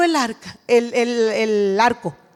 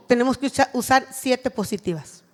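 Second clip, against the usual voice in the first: normal talking voice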